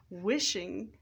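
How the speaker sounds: usual speaking voice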